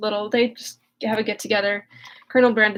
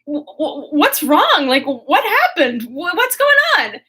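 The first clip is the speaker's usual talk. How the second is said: panicked; 4 semitones higher, 4 dB louder than usual. Retold or imitated. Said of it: "eventually Marianne's like".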